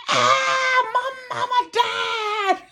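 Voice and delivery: high, hammed-up tearful wail; crying wail. Telling him her mama died, wailing in tears. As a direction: burst into a mock sobbing cry. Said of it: "Now I'm crying".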